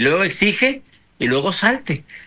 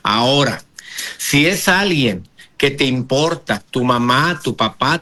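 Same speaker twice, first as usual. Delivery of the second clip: ordinary delivery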